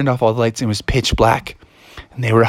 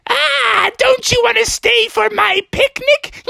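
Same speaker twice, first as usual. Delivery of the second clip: high, wicked taunt; taunting goblin villainess. Acted. Anne chases frogs with pumpkins, high cackling taunt. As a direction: tease your prey, then cackle.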